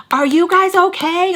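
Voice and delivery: insistent bystander. Retold or imitated. People banging on stall door, high insistent call. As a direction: repeat the call with rising insistence. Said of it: "banging on the freaking door".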